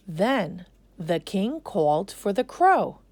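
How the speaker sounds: ordinary delivery, nothing marked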